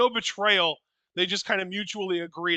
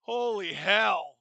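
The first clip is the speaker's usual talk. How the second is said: ordinary talk, nothing marked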